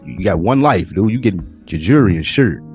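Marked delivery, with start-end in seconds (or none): none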